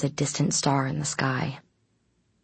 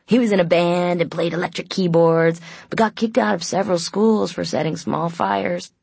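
higher, louder, playful character voice; snickering Aiden, gleefully improvising; 4 semitones higher, 4 dB louder than usual. A narrator's reading. Aiden makes up a cover story, playfully mocking. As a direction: spin the tall tale with mocking relish.

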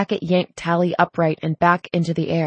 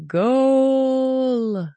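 high, drawn-out "Goal" call; sustained high sports-style goal cry; long cried-out goal; 7 semitones higher than usual. A narrator's reading. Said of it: "he cried out a long".

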